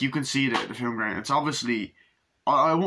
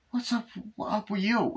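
high-pitched quote; 12 semitones higher than usual. Retold or imitated. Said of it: "It's like".

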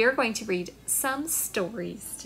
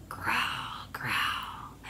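low whispered growl; 12 semitones lower, 7 dB quieter than usual. Shown as a demonstration. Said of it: "make a big growl".

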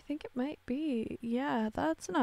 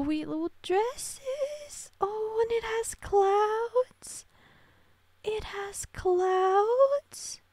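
high, slow, louder squeal of delight; 10 semitones higher, 5 dB louder, much slower than usual. Real, not acted. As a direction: stretch each word out in a squeal. Delighted at cloud dress.

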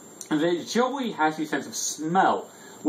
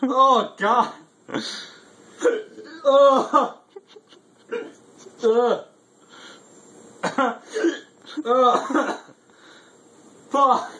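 high, loud groans of burning pain; pained yelling; 10 semitones higher, 4 dB louder, much slower than usual. Real, not acted. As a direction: yell out against the heat. Reacting to burning hot sauce.

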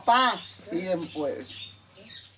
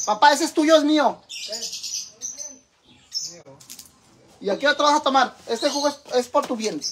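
calling across the room; 5 semitones higher than usual. Real, not acted. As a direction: raise the voice to reach him.

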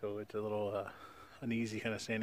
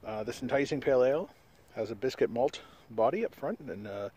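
reading a beer label aloud, a bit louder; 3 semitones higher, 5 dB louder than usual. Acted. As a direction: read it like a product blurb.

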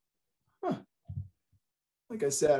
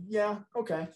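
hesitant yes; 3 semitones higher than usual. Shown as a demonstration. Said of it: "A confirmation yes might be pretty succinct".

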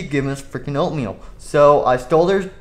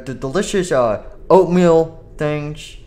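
usual speaking voice

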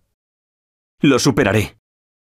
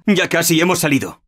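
normal talking voice

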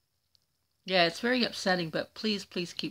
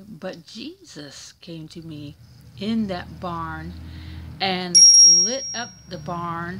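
dramatic reading; 13 dB louder, slower than usual. Retold or imitated. Reading a comment about a vision.

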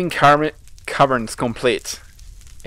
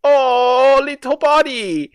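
loud, much higher coo; high-pitched cooing; 13 semitones higher, 7 dB louder, slower than usual. Real, not acted. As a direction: coo high at a little pet. Cooing at creature in game.

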